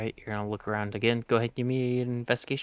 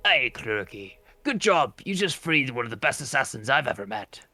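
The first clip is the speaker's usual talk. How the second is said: grinning character voice, lilting up and down; Nex, grinning; 2 semitones higher than usual. Acted. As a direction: praise them through a grin, in character. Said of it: "and grins and goes".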